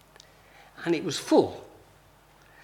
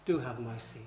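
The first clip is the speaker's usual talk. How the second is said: low, very soft courteous voice; soft polite offer; 7 semitones lower, 14 dB quieter than usual. Retold or imitated. Preacher as the huge teenager giving up a seat, softly polite. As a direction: offer it gently, much quieter and lower.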